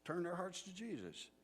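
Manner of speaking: high-pitched preacherly appeal; earnest old preacher. Retold or imitated. Preacher counseling boys on finding partners, high and earnest. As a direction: plead with them in a preacher's lilt. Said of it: "middle-aged old Pharisee gathers his thoughts".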